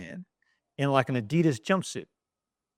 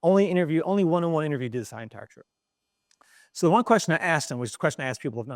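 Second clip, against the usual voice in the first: ordinary talk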